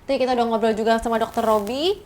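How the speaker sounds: ordinary talk, nothing marked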